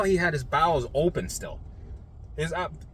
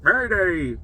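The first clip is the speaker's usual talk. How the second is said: mock alarm; 4 semitones higher, 4 dB louder than usual. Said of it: "my anxiety went".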